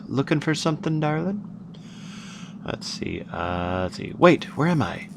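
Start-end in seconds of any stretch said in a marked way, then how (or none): 0.1-1.4 a lightly voiced silky-voiced lady; purring the question to a stranger, only a hint of the silky voice; soft silky lady. A narrator's reading. Narrator voices the silky lady greeting the listener, lightly purred. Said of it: "a lady with a soft, silky voice".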